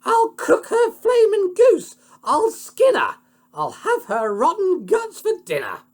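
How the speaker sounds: very high, loud screaming queen; furious yell. A narrator's reading. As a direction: scream the threats in a rage. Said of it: "She yelled".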